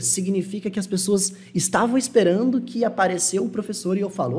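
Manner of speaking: ordinary talk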